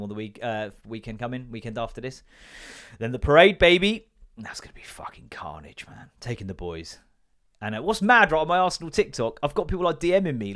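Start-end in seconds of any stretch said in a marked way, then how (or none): 4.4-6.1 soft unvoiced whisper. Real, not acted. Fan whispering about the parade, unvoiced. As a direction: breathe it out low. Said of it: "whispered aside".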